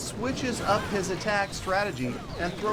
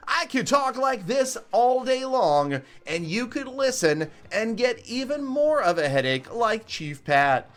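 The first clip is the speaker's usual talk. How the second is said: higher, louder and slower put-on voice; drawn-out, raised put-on voice; his other voice; 5 semitones higher, 4 dB louder, slower than usual. Shown as a demonstration. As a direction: show off the annoying voice he could use all day. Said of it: "Chief Pat hates it when I talk like this".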